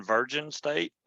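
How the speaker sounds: Virgin for Virginia, last letters dropped; clipped-off ending. Shown as a demonstration. Joking about his state, Virgin with the ending dropped. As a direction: say Virgin with the "ia" left silent. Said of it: "take the last couple letters off".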